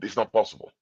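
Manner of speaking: ordinary talk, nothing marked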